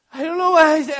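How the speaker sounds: loud, high hammed-up wail; mock bawling. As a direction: wail like a small child caught out. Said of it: "start bawling".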